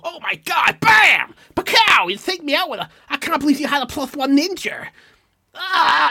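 imitating a kid wildly yelling at a card game; overexcited game yelling. Teenage card player yelling at his friends, hammed-up excited shout. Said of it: "he'd make all these sound effects".

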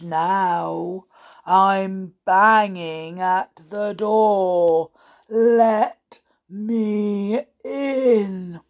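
slow, hollow bogeyman threat; deep, hollow voice. Acted. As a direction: draw out each word to frighten her. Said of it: "a deep, hollow voice came to her ear".

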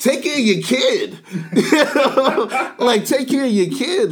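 high, loud laughing exclamation; laughing outburst. Retold or imitated. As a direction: blurt it out through laughter. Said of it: "but I'm like".

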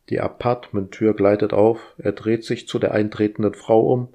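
usual speaking voice

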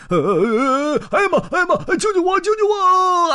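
high, loud, frantic calling; panicked cry for help. Acted. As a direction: shout for Elmer in a panic. Elephant slipping on ice.

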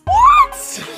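very high, loud squealed what; mock astonishment. Host marveling at the singer's whistle note, mock-astonished squeal.

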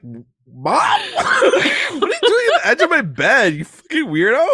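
high, loud, laughing shout; mock startled yell. Podcaster imitates Betty yelling at her mom, high and loud. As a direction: yell at a creepy intruder, half laughing.

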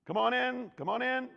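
loud, high call to the flock; calling shepherd. Pretend. Preacher voicing a shepherd calling sheep, loud call. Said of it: "the shepherd's like".

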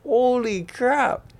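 high, slow, drawn-out holy crap; stunned awe. Retold or imitated. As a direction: let the amazement stretch out. After hitting a deep shot.

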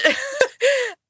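high shaky laugh through tears; teary laugh. Real, not acted. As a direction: let a teary laugh break out. Teary laugh after advice.

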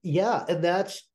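blocked-nose nasal tone. Real, not acted. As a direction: talk with stuffy sinuses. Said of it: "I sound so nasally".

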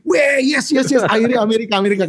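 a giddy mock cheer; imitating excited wife. Wife's excited reaction retold.

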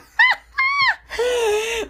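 high shrieking laugh; high wailing belly laugh; helpless laughter. Real, not acted. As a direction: let the laugh run away with you. Keeps laughing.

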